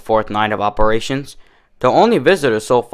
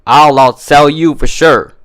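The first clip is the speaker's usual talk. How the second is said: louder, slower confident boast; boastful; 4 semitones higher, 5 dB louder, slower than usual. A narrator's reading. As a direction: brag to your rival. Boastful bet with rival.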